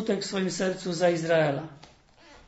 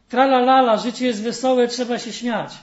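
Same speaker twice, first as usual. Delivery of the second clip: a hammed-up carefree singsong, much louder; mock cheerful; 5 semitones higher, 8 dB louder than usual. Sadness mocking people's forced cheer, hammed-up singsong. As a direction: mimic people's fake cheer. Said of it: "Mówią".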